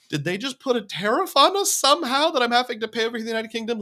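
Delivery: high, quick incredulous question; baffled disbelief. Retold or imitated. Traveler puzzling over an unexpected tariff, baffled. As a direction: ask it fast and high, puzzled.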